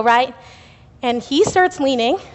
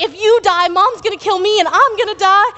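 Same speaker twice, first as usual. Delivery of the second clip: retold frantic yell; 8 semitones higher, 3 dB louder than usual. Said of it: "And he was like".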